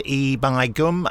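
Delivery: slow, drawn-out northern "E by gum"; a light northern "E by gum", said slowly as a cue; northern accent. Retold or imitated. Director cues the actor with a slow, lightly northern "E by gum". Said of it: "So I used to go".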